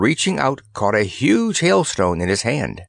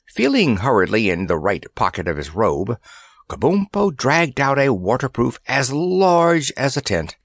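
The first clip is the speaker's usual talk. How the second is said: ordinary delivery, nothing marked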